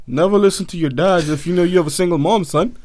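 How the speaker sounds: normal talking voice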